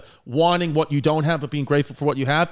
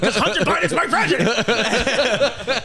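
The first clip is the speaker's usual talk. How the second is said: slow, higher, laughing punchline; laughing joke retort; 7 semitones higher, slower than usual. Retold or imitated. Joking to a friend about his reply, slow and laughing. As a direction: drag it out through a laugh. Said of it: "I was like".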